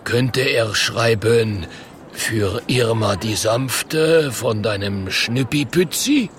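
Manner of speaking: slow, halting, stretched troll voice; hesitant troll. Acted. Potato troll requesting a love note, halting and slow. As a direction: feel your way slowly through the request. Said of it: "stottert der Kartoffeltroll".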